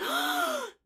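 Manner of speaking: a sudden high intake of breath; sharp gasp. Retold or imitated. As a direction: gasp as if you feel the tension. Telling of the tense moment.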